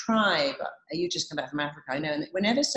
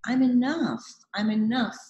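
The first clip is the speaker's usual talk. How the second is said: emphatic affirmation; 6 semitones higher, 4 dB louder than usual. Retold or imitated. Affirming herself before a daunting moment.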